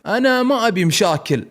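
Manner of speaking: scared. Acted. Salem nervously telling the caller he wants no trouble. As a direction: plead nervously. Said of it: "وهو خايف".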